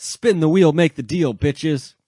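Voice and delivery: loud, high hyped-up shout; mock yelling. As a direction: shout it out like a mock hype man. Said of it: "what we're yelling about".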